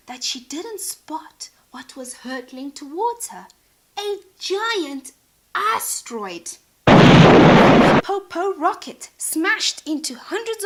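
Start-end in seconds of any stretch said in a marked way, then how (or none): 4.0-10.6 much louder, slower, big impact; dramatic crash. A narrator's reading. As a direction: slam the crash. Crash narration.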